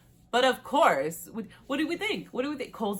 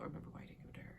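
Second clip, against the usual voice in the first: rushed unvoiced aside; quick whisper; 2 semitones lower, 17 dB quieter, much faster than usual. Real, not acted. Recapping a show, a hurried whispered aside to viewers. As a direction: whisper it quickly, half to yourself.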